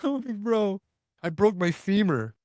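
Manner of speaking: high, panicked, imitated; mock panicked cry. Hurt hunter telling his buddy he broke his femur, high mock panic. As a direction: pitch it up like a scared hurt man.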